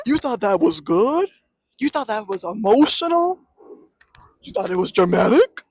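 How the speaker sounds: loud incredulous questions, hammering each one; mocking disbelief. Real, not acted. Critic to fans of the scene, mocking disbelief.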